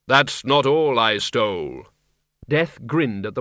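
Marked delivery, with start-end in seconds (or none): 0.1-1.9 grim reaper voice. Acted. Death answers.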